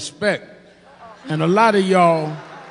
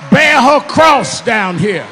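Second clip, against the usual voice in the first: higher, louder shouted preaching climax over the organ; preaching at full force; 8 semitones higher, 8 dB louder than usual. Acted. Preacher closing the eulogy to the church, full-force shouted preaching. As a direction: whoop it home, crown the queen.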